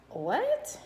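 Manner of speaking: high, incredulous what, pitch shooting up; reacting as if you can't believe what you just heard; stunned what. To a woman asking for her kids' social security numbers, a high, disbelieving what. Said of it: "And I was like".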